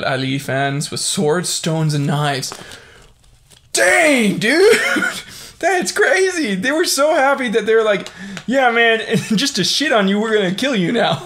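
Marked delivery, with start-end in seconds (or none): 3.7-6.5 high, loud astonishment with a laugh; genuinely floored, voice way up; amazed outburst. Real, not acted. Shocked by swords in riot. 6.6-11.1 loud, high-pitched mock cheer with laughter; incredulous, hammed-up quote; mock celebrating fans. Acts out fans while amused.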